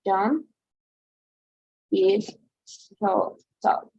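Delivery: John is so tall said slowly with pauses; slow, careful teacher read of John is so tall; slow, spaced-out example. Shown as a demonstration. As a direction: spell it out word by word, slowly. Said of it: "For example, let's take a view".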